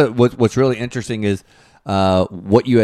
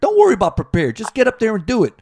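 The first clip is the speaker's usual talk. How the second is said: pushy Northeast guys; 12 semitones higher than usual. Retold or imitated. Northeast buddies pressuring a friend to be funny, pushy. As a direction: demand a laugh like a tough buddy.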